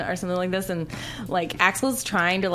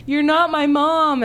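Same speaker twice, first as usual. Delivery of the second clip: loud, drawn-out, higher-pitched cry; mock protest; 8 semitones higher, 7 dB louder, much slower than usual. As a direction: act out a loud, slow, high protest. Retold film line, acted out.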